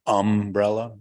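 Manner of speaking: "umbrella" with a drawn-out, stressed "um"; UM-brella, front-stressed. Retold or imitated. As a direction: lean hard on the first syllable of "umbrella". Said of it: "Emphasis on the um".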